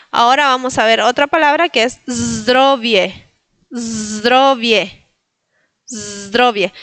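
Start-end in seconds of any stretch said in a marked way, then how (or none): 2.1-6.7 zdrowie with a held buzz before dro-vie; vibrating Z. Shown as a demonstration. Teacher presenting a new word, long voiced z. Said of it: "Ven que digo la z".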